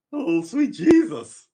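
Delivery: high, loud, drawn-out scandalized cry; mock shock. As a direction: exclaim slowly in exaggerated, scandalized disbelief. His shocked reaction to the confession story.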